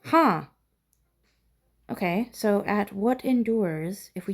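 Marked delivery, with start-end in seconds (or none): none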